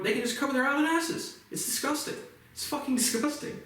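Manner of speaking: real outrage at cops covering up; outraged. Real, not acted.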